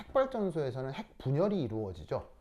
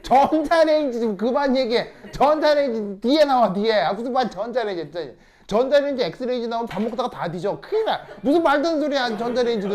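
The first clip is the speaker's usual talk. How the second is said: loud, high comic rant; exasperated scolding; 7 semitones higher, 11 dB louder than usual. Real, not acted. Teacher fed up with microwave answers, exasperated shouting. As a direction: shout them down, laughter creeping in.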